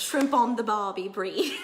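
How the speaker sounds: loud, drawn-out mock Australian accent; broad Australian caricature, called out loud; bad Australian accent. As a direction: ham up a terrible Australian accent. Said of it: "Australian accent ever".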